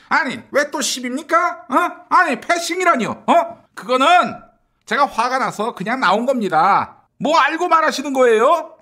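high-pitched, defensive outrage; indignant protest. Acted. Accused politician defending his walkout, indignant protest.

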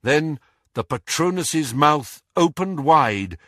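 usual speaking voice

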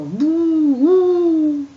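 high, loud scaring boo. Acted. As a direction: shout boo to startle him. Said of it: "en criant très fort".

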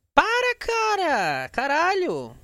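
high, drawn-out frightened yell; real fright, shout at the cat; scared shout. Real, not acted. Spooked by staring cat.

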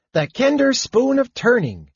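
very high, proudly announcing kender. A narrator's reading. Kender presents his spoon to Palin, proudly. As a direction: show it off in a high, pleased voice. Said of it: "Tass said proudly".

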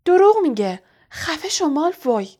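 loud, high angry outburst. A narrator's reading. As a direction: snap back furiously at a bully. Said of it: "با عصبانیت مشتش را". (با عصبانیت و صدای بلند)

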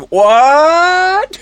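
loud high startled yelp; shocked what. Retold or imitated. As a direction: cry out in surprise. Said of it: "Tastes like".

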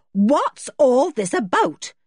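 high, slow, cross question; indignant and emphatic; fierce demand. A narrator's reading. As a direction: demand an explanation sharply. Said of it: "quite fiercely".

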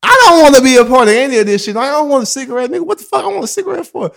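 high, fast protest, loud at the start; exasperated refusal. Retold or imitated. Man refusing his workmate's cigarette begging, exasperated. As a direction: burst out fast and indignant.

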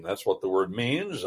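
ordinary talk

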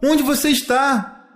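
high, drawn-out searching holler; long vowels, pitched high; calling out. A narrator's reading. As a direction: cry out for your lost brother.

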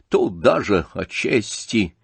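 plain reading voice

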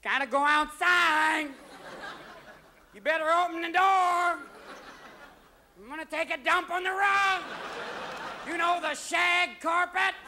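high, slow yowling cat voice; whining, insistent cat threat; demanding cat. Acted. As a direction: draw each phrase out in a high yowl. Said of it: "All of a sudden, your cat wanders downstairs going".

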